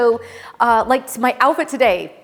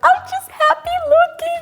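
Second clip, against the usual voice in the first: giddy squeal; 4 dB louder than usual. Retold or imitated. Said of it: "And I'm like".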